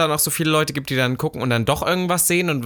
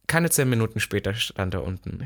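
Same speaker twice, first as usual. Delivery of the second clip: ordinary delivery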